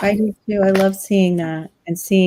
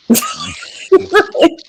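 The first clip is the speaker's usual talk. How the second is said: sharp scoffing laugh; 3 dB louder than usual. Real, not acted. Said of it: "bitter laugh".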